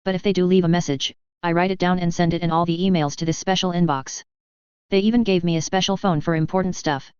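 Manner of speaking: ordinary delivery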